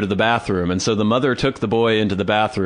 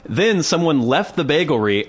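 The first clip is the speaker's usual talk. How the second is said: usual speaking voice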